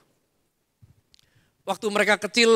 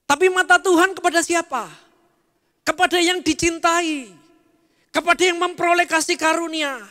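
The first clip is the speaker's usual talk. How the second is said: fervent preaching; 6 semitones higher, 5 dB louder than usual. Acted. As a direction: drive the promise home to the congregation. Preaching at full force.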